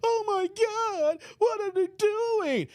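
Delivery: high, drawn-out mock wail. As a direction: imitate a tearful meltdown, slow and high-pitched. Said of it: "[name] is crying her ass out".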